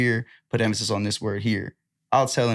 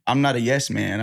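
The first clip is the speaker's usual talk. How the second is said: ordinary talk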